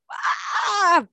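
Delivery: long, high "aah" of alarm; drawn-out slow-motion yell; mock dodge yell. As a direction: yell it as if dodging a flying bottle. Imitates her Matrix dodge.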